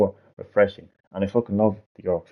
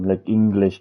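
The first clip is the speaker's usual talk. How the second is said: normal talking voice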